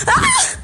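high laughing scream; laughing shriek. Real, not acted. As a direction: squeal while running from the dog. Running from barking dog.

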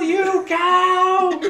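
high, loud yell; dramatic shout. Acted. The doctor yells at the cow as he throws the degree, high and loud. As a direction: hurl the insult.

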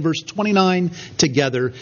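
ordinary talk

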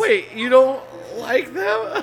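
high, slow disbelief, wait stretched out; can't believe what he's hearing; shocked. Real, not acted. Shocked he dislikes the dessert.

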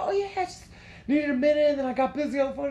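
very high-pitched cover story; mock innocent excuse. Boyfriend covering up to girlfriend, mock innocence. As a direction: play nonchalant in a squeaky-high voice.

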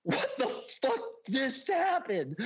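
high, slow, astonished retelling; stunned disbelief. Retold or imitated. Seeing his brother flung back unconscious, high stunned disbelief. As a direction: stretch it out high in amazement. Shock after brother passes out.

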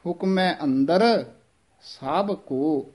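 small pause after ਅੰਦਰ before ਸਭ ਕੋ; ਹੁਕਮੈ ਅੰਦਰ, then a short hold, then ਸਭ ਕੋ. Shown as a demonstration. Teacher showing students the pause after ਅੰਦਰ. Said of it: "ਅੰਦਰ ਪਦ ਤੇ ਥੋੜ੍ਹਾ ਜਿਹਾ ਠਹਿਰਾ ਦੇ ਕੇ".

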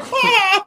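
high yelp of alarm; mock shriek. Vet imitating own startled yelp at the client, high. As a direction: squeal as if startled. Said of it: "and I'm like".

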